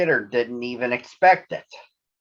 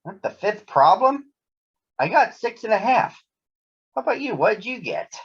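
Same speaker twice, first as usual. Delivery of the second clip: put-on higher young boy voice, questioning; Cousin Freddie; 9 semitones higher than usual. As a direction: sound like an eager kid cub. Cousin Freddie asks about homework.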